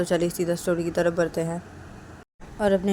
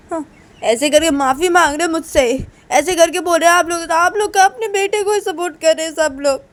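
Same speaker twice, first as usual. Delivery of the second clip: high, loud wounded accusation; acted tirade of complaint; aggrieved outburst; 9 semitones higher, 8 dB louder than usual. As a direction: pour out the grievance at raised pitch. Uma accuses the family.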